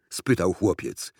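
ordinary delivery

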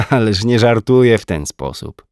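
light teasing laugh in the voice; woman teasing her jealous man, laughing. A narrator's reading. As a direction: say it through a small laugh. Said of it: "zaśmiała się".

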